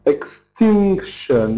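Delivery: "Extinction" slowly, ex-TINK-shun; stress before suffix. Shown as a demonstration. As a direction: draw out the TINC of "Extinction". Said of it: "stress before tion".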